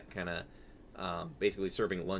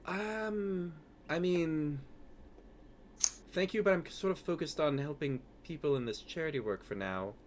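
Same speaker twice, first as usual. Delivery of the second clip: higher-pitched, hesitant charity worker; lightly flustered, higher character voice; Safia, polite and demurring; 7 semitones higher than usual. Acted. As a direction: politely brush them off in a higher voice.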